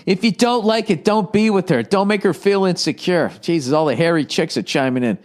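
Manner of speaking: normal talking voice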